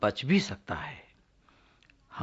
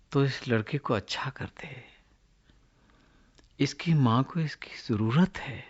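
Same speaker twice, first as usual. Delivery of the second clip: fervent prayer; 5 dB louder than usual. Acted. Prophet begs God to heal a boy, fervent prayer. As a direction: plead with God, phrase by phrase.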